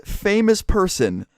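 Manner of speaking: high, drawn-out mocking quote; incredulous mimicry. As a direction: repeat the insult slowly, pitched well up. Repeats her insult in disbelief.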